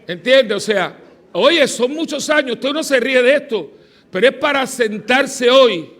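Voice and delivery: loud, high emphatic preaching; fiery preaching. Acted. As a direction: preach it to the congregation with fire. Preaching at force.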